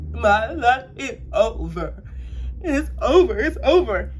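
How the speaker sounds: dramatic high-pitched despair, retold; depressed. Retelling her reaction to the positive test, hammed-up despair. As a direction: wail it in mock despair, drawn out at first. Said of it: "I'm, like, depressed".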